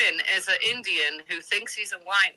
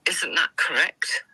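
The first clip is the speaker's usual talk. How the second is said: ordinary delivery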